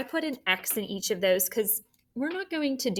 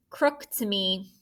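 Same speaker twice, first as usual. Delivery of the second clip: ordinary delivery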